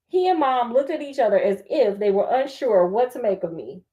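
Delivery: plain reading voice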